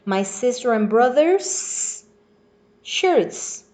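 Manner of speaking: drawn-out S on brother's, then a pause before shirts; emphasized possessive S on brother's. Shown as a demonstration. Teacher reminding pupils of apostrophe S, S on brother's stretched. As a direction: hold the S ending brother's, then land shirts separately. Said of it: "apostrophe S".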